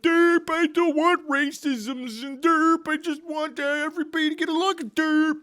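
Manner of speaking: high monotone dopey mimicry; mock them in a loud, high dumb voice; hammed-up idiot chant on one pitch; useful idiot. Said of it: "useful idiots who are just like".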